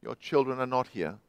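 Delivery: low, soft, hollow echo voice; awful echo. A narrator's reading. The echo answers the lost man, low and soft. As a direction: answer flat and cold from the dark. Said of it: "that awful echo".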